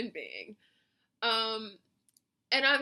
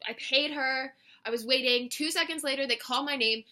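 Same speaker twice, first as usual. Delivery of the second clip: ordinary talk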